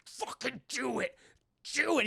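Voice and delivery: strained, high choked voice, imitating; being choked. Man being choked dares his attacker, mock strangled voice. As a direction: force the words out as if throttled. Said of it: "starts choking the shit".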